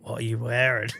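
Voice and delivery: ordinary delivery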